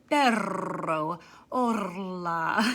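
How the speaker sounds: hola mock-trilled like Perro, over-rolled r; silly rolled Spanish. Shown as a demonstration. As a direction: ham it up with a rolled r. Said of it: "That's not how you say hola at all".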